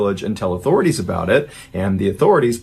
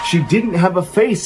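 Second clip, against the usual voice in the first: higher, near-shouted horror; horrified cry; 7 semitones higher than usual. Retold or imitated. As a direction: cry it out in terror. Terrified mother reveals what she saw.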